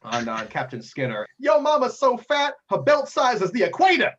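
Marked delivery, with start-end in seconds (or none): 1.4-4.1 swaggering pirate jeer, pitched way up; brash one-liner. Acted. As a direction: belt out the yo mama joke high. Pirate captain trash-talk one-liner.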